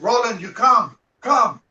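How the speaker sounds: loud, high summons to a student; calling out. Retold or imitated. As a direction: call him over with authority. Mentor calling student for oath.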